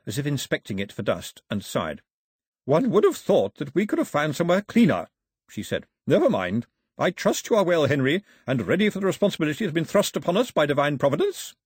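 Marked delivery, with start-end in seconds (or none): 2.6-5.1 louder, higher haughty grandmother; grandmother, disapproving. Acted. 6.1-11.5 louder, higher haughty lady; grandmother, imperious. Acted.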